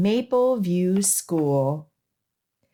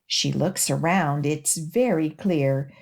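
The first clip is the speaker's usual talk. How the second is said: usual speaking voice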